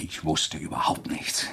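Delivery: slow, unvoiced confession; a slow, deliberate whisper. Revealing his bluff to killer.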